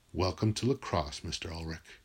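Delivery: lightly voiced coach, low and quiet; coach's put-down. Coach leaning over a winded player, quiet put-down. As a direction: drop low and soft, trailing away.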